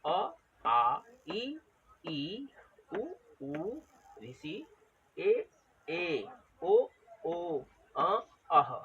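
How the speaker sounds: short vowels light, long vowels stretched; spaced-out teacher's vowel recital; vowel length drill. Shown as a demonstration. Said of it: "वजन देंगे और लंबा खींचेंगे".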